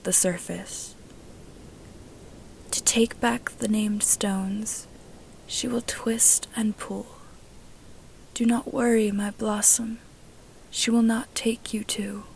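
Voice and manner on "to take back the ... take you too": soft whispered memory; softer hushed recollection; remembered voice. A narrator's reading. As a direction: breathe it like a voice echoing in the mind. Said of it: "Sakura's echo plays the memory".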